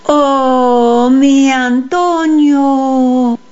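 long, high, drawn-out calling; echoing distant call. A narrator's reading. A voice from the valley calls Antonio, echoing call. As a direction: stretch the vowels as if calling across a valley. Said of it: "La voz se oía como un eco".

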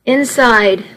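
plain reading voice

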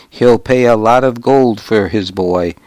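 plain reading voice

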